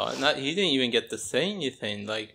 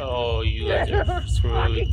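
slow angry threat; 6 dB louder, slower than usual. Real, not acted. Angry at game villains.

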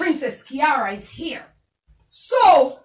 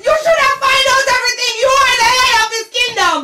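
high, loud, fast tirade; furious shout; 7 semitones higher, 4 dB louder than usual. Acted. Queen berating the head of the kingdom, furious shout. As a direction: tear into him, high and loud.

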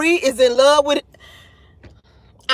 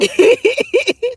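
high-pitched real laugh; 9 semitones higher than usual. Real, not acted. Host amused by her own name mix-up, laughing.

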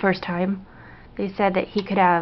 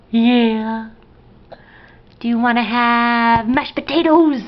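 loud, drawn-out, higher-pitched enthusiasm; 4 semitones higher, 5 dB louder, slower than usual. Real, not acted. Parent offering baby Thanksgiving food, excited baby talk.

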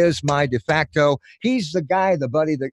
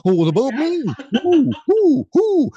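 high hooting cartoon owl; 12 semitones higher than usual. Voice actor doing the owl from the beeper ad, high hoots. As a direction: hoot the who's like a fussy owl. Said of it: "And the owl".

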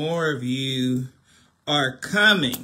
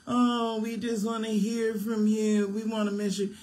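mocking fans; 7 semitones higher than usual. Preacher mocking fans who message him, high whiny voice. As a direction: mimic the clingy fans in the inbox. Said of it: "Do not be all in my inbox".

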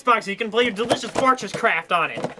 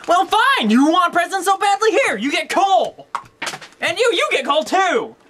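spiteful outburst; 10 semitones higher than usual. Acted. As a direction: hand out the coal in a rage. Toy angrily hands out coal.

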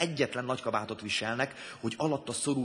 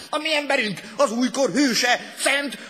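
howling proclamation; 12 semitones higher, 11 dB louder than usual. A narrator's reading. Leader proclaiming a new saint to members, howling. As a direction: shout it like a rally climax. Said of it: "süvöltötte a lenti tagoknak".

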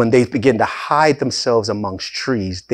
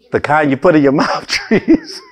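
breaking into a laugh on the last word; real laughter bubbling through the words; 6 semitones higher than usual. Real, not acted. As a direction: crack up at your own joke. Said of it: "I'm laughing 'cause it's".

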